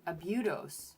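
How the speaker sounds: "abudos" as uh-BYOO-dohs, falling; U-sound pronunciation. Shown as a demonstration. Streamer testing a U sound, uh-BYOO-dohs "abudos". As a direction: say "abudos" with a YOO in the stressed middle. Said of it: "U sound".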